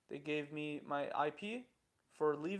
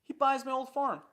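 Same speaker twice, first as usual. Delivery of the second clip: usual speaking voice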